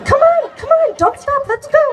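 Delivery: high excited urging. Retold or imitated. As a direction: hurry them along through the crush. Urging friends through crowd.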